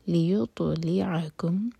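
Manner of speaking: لیطلعکم slow, ط bounced with qalqala; bounced taa. Shown as a demonstration. Teacher repeats the word with a clear bounce. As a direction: recite لیطلعکم slowly with a bounce on ط. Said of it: "تو کو ہم bounce کریں گے".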